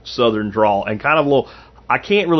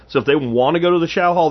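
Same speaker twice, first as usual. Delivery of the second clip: ordinary talk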